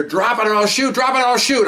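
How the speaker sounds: high, loud shouted command; police shout. Retold or imitated. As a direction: bark the order at a suspect with a gun. Lead detective yelling at armed kid.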